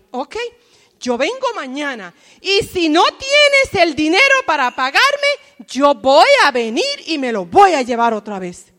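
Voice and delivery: amenaza dura y más fuerte; cobrador amenazante. Acted. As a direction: advierte con voz fuerte y seca. (el cobrador amenaza a la viuda, fuerte y duro)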